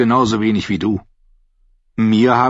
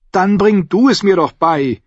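put-on high, louder boyish challenge; defiant boy; 10 semitones higher, 4 dB louder than usual. A narrator's reading. Bran challenging Jojen to teach him, high defiant boy. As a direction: throw it back at him like a stubborn boy.

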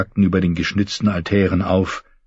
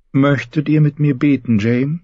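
put-on higher, devout young knight; Lancel; 8 semitones higher than usual. Lancel confessing his new faith to James, earnest. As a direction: speak like a pious, fervent cousin.

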